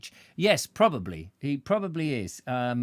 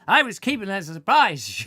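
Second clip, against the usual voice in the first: louder, higher "I was keeping that as a surprise", playfully put out; mock indignation; 4 semitones higher, 7 dB louder than usual. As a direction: act huffy that the surprise is spoiled. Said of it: "You ruined my surprise".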